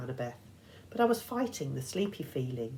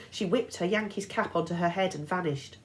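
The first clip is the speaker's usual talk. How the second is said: ordinary delivery, nothing marked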